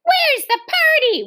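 put-on high, loud little boy voice; small boy. A narrator's reading. Little boy demanding to know where the party is. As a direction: ask eagerly like a tot.

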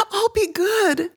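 put-on a small girl desperate to make it stop; pleading crying child. A narrator's reading. Girl begging stepfather after beating, high tearful voice.

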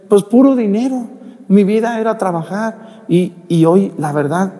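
high, plaintive lament of a patient; tearful sick man. Retold or imitated. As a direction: imitate a man weeping over his regrets. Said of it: "ese señor estaba llorando".

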